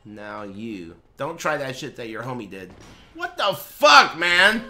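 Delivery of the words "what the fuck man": high, loud, drawn-out outburst; exasperated shout. Real, not acted. Streamer reacting to game mishap, exasperated shout. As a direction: yell it in disbelief, stretching the words.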